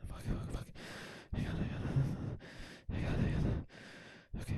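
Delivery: frantic whispered muttering; unvoiced, breathy whisper; breathing into mic. Real, not acted. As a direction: whisper under your breath, right on the mic. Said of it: "Stop breathing like that in the mic".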